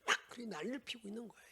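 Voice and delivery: fast whispered aside; quick hushed aside to the congregation. Real, not acted.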